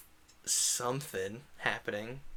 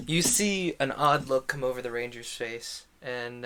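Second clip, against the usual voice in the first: ordinary delivery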